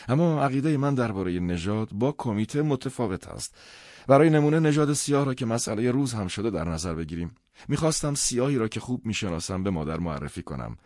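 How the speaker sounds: argumentative son. Acted. Son argues to his father about race and the committee, animated.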